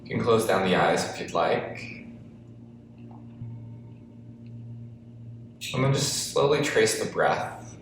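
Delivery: guided breathing meditation. Acted. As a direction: ease them into the breath, unhurried. Leads seated breathing practice.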